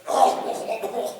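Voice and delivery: loud, hacking mock cough; imitated coughing fit. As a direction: act out a big coughing fit.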